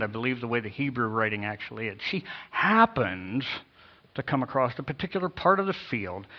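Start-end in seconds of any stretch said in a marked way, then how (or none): 2.1-3.4 slow, swooping emphasis on "happens"; knowing inflection. Shown as a demonstration. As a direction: stretch "happens" as a knowing hint. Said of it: "with an inflection in my voice".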